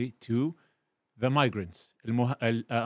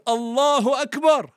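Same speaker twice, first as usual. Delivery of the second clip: much higher, louder triumphant shouting; joyful loud cry; 11 semitones higher, 7 dB louder than usual. Acted. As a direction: cheer like a victorious crowd. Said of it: "their response was".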